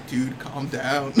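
lightly voiced brother easing him down; calming big brother. Big brother tells the yelling clerk off, slow imitated calming.

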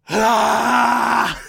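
loud, high, drawn-out "aah"; sudden comic shriek of panic; mock panicked scream. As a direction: let out a hammed-up scream. Acting out the freak-out reaction.

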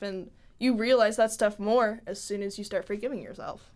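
ordinary talk

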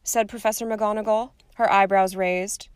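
usual speaking voice

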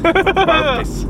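burpees squeaked way up high in disbelief; amused high-pitched scoff; mock disbelief. Real, not acted. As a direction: scoff at the very word. Mocking the idea of burpees.

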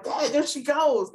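high, eager whisper-shout to dad; excited child. Retold or imitated. Girl spotting her idol, telling dad eagerly. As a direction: point her out, thrilled. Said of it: "She's like".

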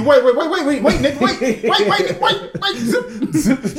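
frantic laughing. Retold or imitated. Acting out panicked porn reaction.

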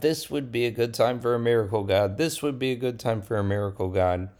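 fast, rushed repeated plea; impatient repeated prayer; repeated pleading. Acted. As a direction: rattle it off again and again. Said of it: "Moshe keeps on crying out".

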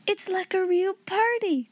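high, excited exclamation; delighted cry. A narrator's reading. Ermengarde delighted by the feast, delighted cry. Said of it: "cried Ermengarde".

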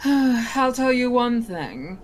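mock sigh. Genie starts teasing.

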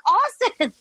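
high-pitched squeal of reproach; mock indignant exclamation. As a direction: scold him playfully in a high squeak. Acted indignant reproach at fiance.

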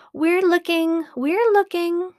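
high, quick little monkey voices; eager little monkeys. A narrator's reading. Six little monkeys reassure the gorilla, high and eager. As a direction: squeak it out like busy monkeys.

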